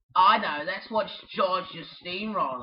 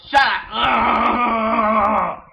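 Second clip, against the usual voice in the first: loud drawn-out shout of rage; kid's toy-fight yell; angry shout; 3 semitones higher, 11 dB louder than usual. Pretend. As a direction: yell it to silence the bird. Toy quarrel with bird.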